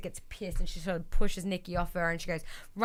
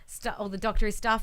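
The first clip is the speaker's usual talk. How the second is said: usual speaking voice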